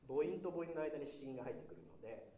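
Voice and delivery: normal talking voice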